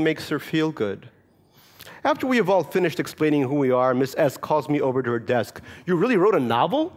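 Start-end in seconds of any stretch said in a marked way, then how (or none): 5.9-6.9 teacher's surprised question. Retold or imitated. Teacher voiced in story.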